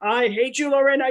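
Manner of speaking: a playful hint of a stern Frenchman; teasing stern boss voice; mock strict Frenchman. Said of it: "very strict Frenchman who was like".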